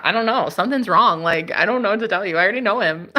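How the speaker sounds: ordinary talk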